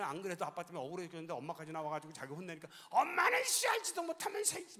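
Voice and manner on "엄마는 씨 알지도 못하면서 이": put-on high, loud furious child's yell; angry yell. Son yelling back at his scolding mother, high furious shout. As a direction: scream it back at mom like a fed-up kid.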